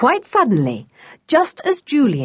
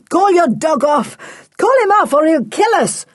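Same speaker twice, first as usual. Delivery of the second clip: high, loud panicked shout; crying out; 9 semitones higher, 4 dB louder than usual. A narrator's reading. Smuggler knocked down by the dog, crying out. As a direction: shout desperately to get the dog called off. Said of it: "cried Mister Barling".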